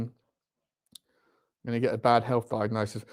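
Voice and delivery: ordinary delivery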